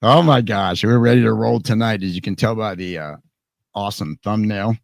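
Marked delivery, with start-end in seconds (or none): none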